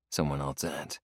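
ordinary delivery, nothing marked

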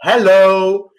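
calling out. Pretend. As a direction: snap them back with a loud hello. Calling out to distracted viewer.

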